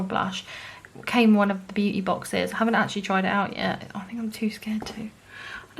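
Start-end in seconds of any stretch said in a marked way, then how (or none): 3.9-5.8 sheepish whisper; whispered confession. Real, not acted. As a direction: whisper it, a bit embarrassed. Admitting fear of blush.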